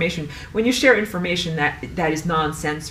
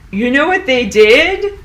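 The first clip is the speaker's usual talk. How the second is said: louder, higher, worked-up challenge; real indignation; 7 semitones higher, 8 dB louder than usual. Real, not acted. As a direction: demand it from a listener, fired up. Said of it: "I'm very mad".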